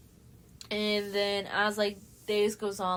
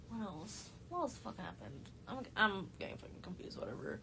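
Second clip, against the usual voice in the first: much softer, to herself; thinking aloud; 10 dB quieter than usual. Real, not acted. Losing her thread, talks to herself.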